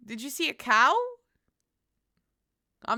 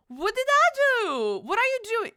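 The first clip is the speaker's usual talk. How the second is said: mock offended; 10 semitones higher, 3 dB louder than usual. Real, not acted.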